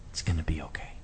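soft unvoiced whisper of comfort; tender whisper. God whispering comfort to a frightened child, whispered. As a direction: breathe it like soothing a frightened child.

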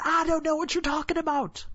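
acted high, insistent denial; nervous denial. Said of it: "And Peter said".

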